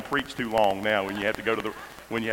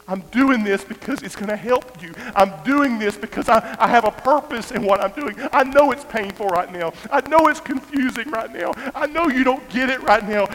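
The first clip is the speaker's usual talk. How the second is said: loud, high, urgent reassurance; impassioned pleading; 11 semitones higher, 7 dB louder than usual. Retold or imitated. Father promising hurting son he'll never leave, impassioned pleading. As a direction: plead at full force, building phrase on phrase. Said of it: "I wanna tell him".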